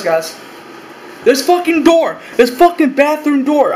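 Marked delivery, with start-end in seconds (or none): 1.2-3.7 worked up. Real, not acted. Vlogger ranting to viewers about his bathroom door, worked up and higher. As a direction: vent about that door, pitch jumping up.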